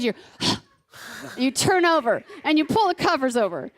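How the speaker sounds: loud and laughing; laughing storytelling. Real, not acted. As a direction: tell it through laughter. Wife acts out huffing in bed.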